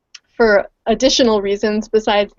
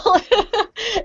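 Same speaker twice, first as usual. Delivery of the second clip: quick laugh, much higher; light laugh; 11 semitones higher than usual. Real, not acted. Guest laughs at the timing, light laugh. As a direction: break into a short laugh.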